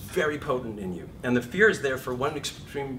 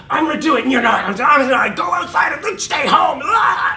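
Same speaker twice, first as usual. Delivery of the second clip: higher, louder manic yelling; frantic inner conflict; 15 dB louder than usual. Acted. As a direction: shout both sides at once, losing it. Two voices clashing, acted frenzy.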